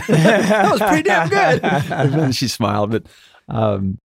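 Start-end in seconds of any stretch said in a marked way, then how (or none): 0.1-1.6 much higher, slow, laughing imitation; laughing mock praise. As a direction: laugh through an impressed imitation of the wife. Wife's impressed reaction, laughing.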